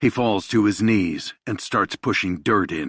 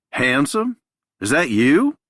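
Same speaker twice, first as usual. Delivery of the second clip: surprised recognition; 8 semitones higher, 6 dB louder than usual. Acted. Donnie recognizes Nico by flashlight.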